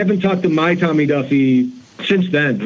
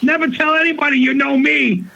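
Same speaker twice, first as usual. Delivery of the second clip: laughing friend; 11 semitones higher than usual. Retold or imitated. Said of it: "He's like".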